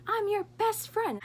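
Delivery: high, hissed whisper yell. Retold or imitated. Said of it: "we're whisper yelling".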